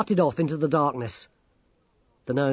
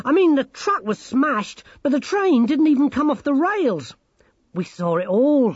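very high, loud character voice; excited Sacco; 12 semitones higher, 7 dB louder than usual. Acted. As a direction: recount it eagerly, pitched way up. Voices Sacco recounting adventure.

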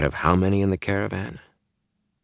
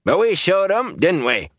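high-pitched shouted boast; shout, rallying the men to agree; 13 semitones higher than usual. A narrator's reading. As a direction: call it out to the whole crowd. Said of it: "Hari Copland shouted".

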